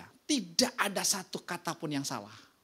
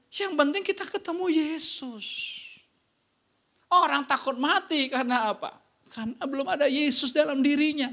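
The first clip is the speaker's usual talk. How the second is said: high, driving sermon voice; fervent preaching; 9 semitones higher, 6 dB louder than usual. Acted. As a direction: preach it out to the whole room. Preaching at full force. (berkhotbah dengan semangat penuh)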